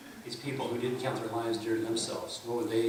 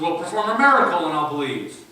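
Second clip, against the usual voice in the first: demanding skeptic; 5 semitones higher, 10 dB louder than usual. Retold or imitated. Skeptic voiced demanding a miracle.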